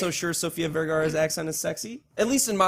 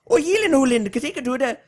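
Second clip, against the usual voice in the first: higher, louder Swedish accent attempt; hammed-up Swedish sing-song; 8 semitones higher, 5 dB louder than usual. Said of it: "base your whole accent off of".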